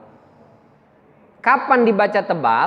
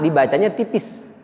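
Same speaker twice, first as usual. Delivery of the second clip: ordinary talk, nothing marked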